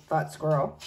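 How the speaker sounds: usual speaking voice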